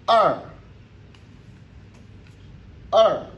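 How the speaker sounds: R said loud and high; loud model syllable for learners; syllable demo. Shown as a demonstration. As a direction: call out R clearly for the class. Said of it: "Encore le E R qui revient".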